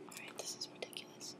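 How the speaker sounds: fast whispered aside; whispering. Real, not acted. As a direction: rush it out in a whisper.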